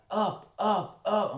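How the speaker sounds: high, loud, insistent call of up, up, up; a waiter's voice, imitated high and loud. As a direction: mimic the guy pointing and urging you to get up. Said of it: "he's like".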